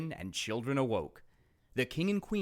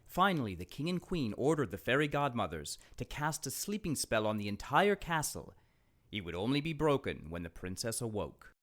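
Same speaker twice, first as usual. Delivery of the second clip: plain reading voice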